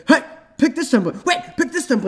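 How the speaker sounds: high, loud pop-up nagging; pushy website voice. Retold or imitated. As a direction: shout it like an annoying ad. Website pop-ups yelling at user.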